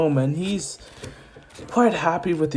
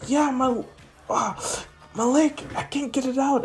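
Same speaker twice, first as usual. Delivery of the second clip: put-on higher girl's voice, strained; girl in pain; 11 semitones higher than usual. Uraraka telling Izuku her leg is stuck, strained higher voice.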